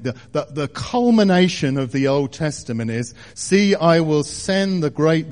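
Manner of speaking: fervent preacher building to a peak; preaching at full force. Acted. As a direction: lift it and press every phrase home. Preaching the Malachi verses loudly.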